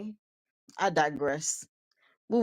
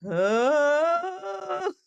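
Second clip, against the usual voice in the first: high strained grunt, imitated; mock pained orca-like groan; wounded mammal groan; 7 semitones higher than usual. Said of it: "the sound orcas make during mating season".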